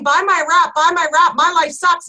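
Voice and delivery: usual speaking voice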